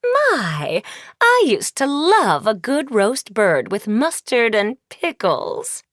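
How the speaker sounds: gloating evil queen; wicked queen. Acted. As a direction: savour the threat like a hungry witch queen.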